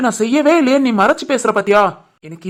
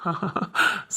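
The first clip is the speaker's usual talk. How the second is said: soft breathy laugh; 6 semitones lower, 12 dB quieter than usual. Acted. Son amused at mother.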